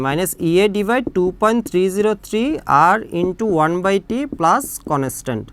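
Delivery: spelled out slowly for notes; slow dictation. Shown as a demonstration. Teacher dictating the equation to students, very slow. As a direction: dictate each term slowly so students can write.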